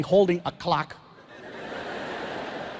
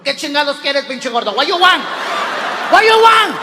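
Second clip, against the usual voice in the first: rising louder, high impatient barks; gruff grumpy cook; 5 semitones higher, 5 dB louder than usual. Retold or imitated. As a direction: snap at the customer. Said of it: "they come to the window".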